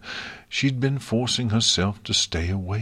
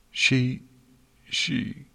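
moaning; 6 semitones higher than usual. A narrator's reading. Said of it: "Twilight moaned".

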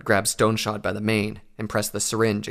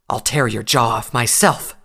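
hissed threat; 7 dB louder than usual. A narrator's reading. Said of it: "Nightcanter hissed".

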